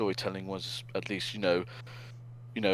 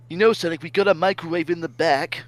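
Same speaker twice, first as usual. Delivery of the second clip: louder, higher sarcastic jab; 7 semitones higher, 7 dB louder than usual. Acted. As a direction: needle him with mock helpfulness. Voicing Manic's sarcastic look.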